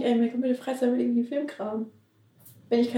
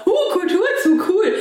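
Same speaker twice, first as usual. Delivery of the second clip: excited; 8 semitones higher, 5 dB louder, slower than usual. Retold or imitated. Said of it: "Und dann war".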